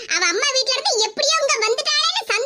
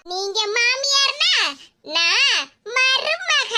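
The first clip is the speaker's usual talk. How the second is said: slow taunt; much slower than usual. Acted. Daughter-in-law taunting her mother-in-law, slow taunt. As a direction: drag each word out, very slow.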